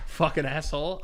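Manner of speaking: ordinary talk, nothing marked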